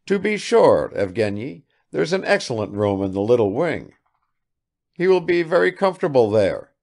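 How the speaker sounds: a hint of a flustered old father; fussy old father. Old father fussing over his son's guest, eager.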